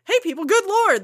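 high, loud exasperated outburst; exasperated Scott. Retold or imitated. Scott fed up with the team, loud exasperated call. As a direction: throw up your hands and call out. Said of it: "That's what Scott says".

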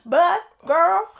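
put-on high, loud southern accent with a rising "girl". Host pushing back at her cohost in a southern accent. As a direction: stretch out "girl" southern-style. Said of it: "in this southern accent".

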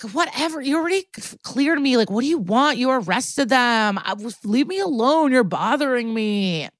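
high-pitched, whiny mock indignation; douche. Douche fending off detectives who bother him, whiny. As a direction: imitate an entitled brat brushing off the police. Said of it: "over to the douche and he's like".

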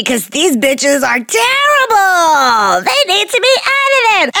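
drawn-out, high hammed-up yell; playacted exasperated shouting; mock outraged listener. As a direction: wail it high, stretching the words. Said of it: "They're gonna be like".

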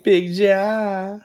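high, drawn-out hype call; excited echo. Real, not acted. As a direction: echo the nickname high and long. Echoes co-host's hype call.

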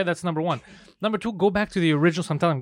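usual speaking voice

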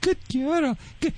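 put-on high, soft Good girl; quiet high praise to the puppy. Shown as a demonstration. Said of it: "you say her".

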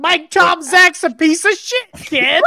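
loud shouting Bears dad. Acted. A Bears dad shouts about Tomczak to a kid, loud. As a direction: yell it from the couch.

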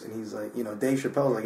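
usual speaking voice

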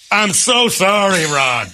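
high, drawn-out wailed apology; comically overwrought and slow; mock desperate apology. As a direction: plead it with hammed-up remorse. Acts out the friend's desperate apology.

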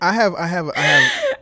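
high, loud laughing cry; laughing shout. Real, not acted. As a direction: shriek it through laughter. Cracking up at her.

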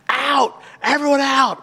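high, slow bellowing yell of an angry man; retold scream. Adult man ordering noisy kids out, high yelling. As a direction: throw everyone out at the top of your lungs. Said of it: "scream top of his lungs".

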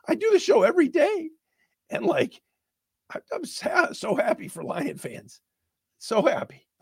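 high, strained, near tears; holding back tears while saying it; emotional. Real, not acted. Host overcome with joy for the fans, near tears. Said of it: "I'm emotional about it".

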